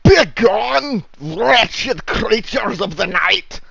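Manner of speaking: high, loud, drawn-out banishing cry; shouting voice. Acted. Guard banishing creatures of the night from the wall, high slow shout. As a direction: bellow it slowly and high, driving them off. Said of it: "A voice shouts back from the wall".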